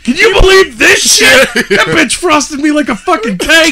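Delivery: high-pitched comic rant; mock outrage. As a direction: escalate into hammed-up indignation. Acts out outraged actor, escalating.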